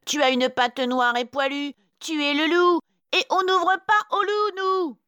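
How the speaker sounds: put-on very high, loud little-kid voices; crying out. A narrator's reading. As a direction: shout defiantly as little goats. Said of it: "les chevreaux s'écrient". (cris aigus des chevreaux)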